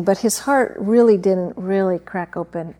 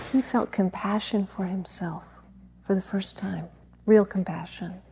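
hushed whisper at the story's heart; breathless whisper of revelation; 6 dB quieter than usual. Real, not acted. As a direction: drop to a whisper, tender and intimate. Tender climax of brother's story.

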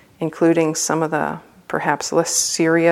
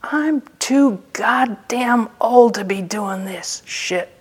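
slow, higher-pitched mock exasperation; retold exasperated outburst; 5 semitones higher, slower than usual. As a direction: drag out each cursing word, imitating her. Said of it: "she tops off and she says".

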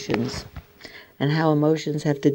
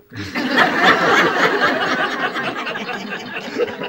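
real airy laugh, higher; breathy laughter; 13 semitones higher than usual. Real, not acted. Squirrel tries knocking bird feeder.

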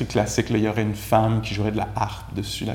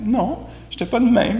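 higher-pitched denial with a hint of protest on "de"; mock high self-correction; 11 semitones higher than usual. Teacher mocking his own denial in a high voice. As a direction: play your own protest, half laughing. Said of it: "C'est ça que j'ai dit".

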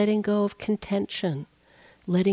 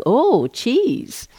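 higher, louder mouse delighted at cheese; eager cartoon mouse; 6 semitones higher, 5 dB louder than usual. Teacher voicing a cartoon mouse lured by cheese, eager and high. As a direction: squeak it out like a tempted little mouse.

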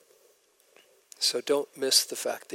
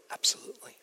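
whispered yes; 5 dB quieter, much faster than usual. Retold or imitated. Asked again if he is sure.

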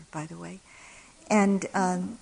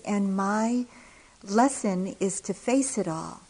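ordinary talk, nothing marked

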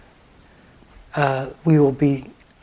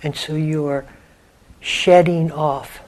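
softer meditation voice with long pauses; guided meditation; 5 dB quieter than usual. Acted. Teacher guiding listeners to let the quiet in, unhurried meditation voice. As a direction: guide listeners gently inward, leaving long silences.

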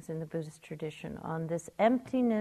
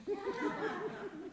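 a light, breathy chuckle; soft laugh; 12 dB quieter than usual. Real, not acted. Teacher laughing with listeners at the joke. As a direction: laugh gently at the punchline.

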